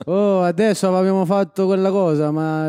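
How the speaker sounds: put-on Italian lilt; Italian accent. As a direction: slip into Italian-accented patter. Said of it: "parlez avec l'accent italien".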